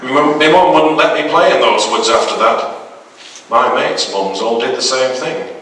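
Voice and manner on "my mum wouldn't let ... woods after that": ordinary delivery, nothing marked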